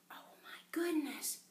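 whispered storybook gasp of dismay; soft, breath-only dismay; hushed mock shock. Story reaction to booming voice.

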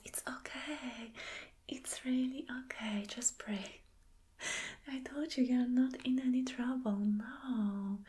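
normal talking voice

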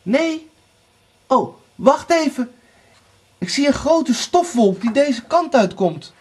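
high, called-down report; lookout sister. A narrator's reading. Anna spots dust from the tower, calling high. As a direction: call out what you spot.

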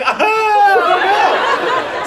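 high-pitched freaked-out exclamation; hammed-up high cry of "aha"; high mock "aha". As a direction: let out a comic high yelp. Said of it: "So I'm like".

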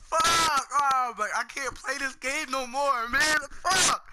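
high, loud frightened shouts; panicked yelling. Real, not acted. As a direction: yell it out in a panic. Panicked after scare.

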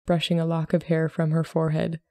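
ordinary delivery

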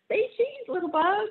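put-on high, loud child voice calling say cheese; a delighted kid snapping pictures; excited little girl. Ruthie photographs everything with her tiny camera, high and gleeful.

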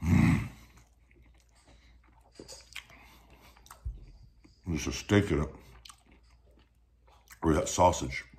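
eating between words; talking while chewing. Real, not acted. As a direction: mouth partly full of food. Seen 0: Burrito in hand, bites just before, chews while talking throughout.

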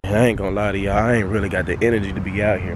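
usual speaking voice

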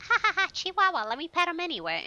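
high, laughing passerby voice; mocking passerby. Imitating strangers at the park cooing over her barking Chihuahua, high and laughing. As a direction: chirp it like a delighted stranger. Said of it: "people are like".

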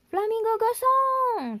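high, slow startled yell; shouting. A narrator's reading. Pak Badru shouts on seeing the flowers. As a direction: cry out in alarm. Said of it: "teriak Pak Badru".

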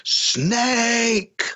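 high shrieking demon scream; piercing retold demon shriek. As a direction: shriek it like a possessed voice. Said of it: "this demon screamed".

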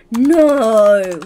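long, loud drawn-out no; loud, stretched cry of disappointment; dismayed outcry. Real, not acted. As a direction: wail it out in dismay. Finding she lacks the emote.

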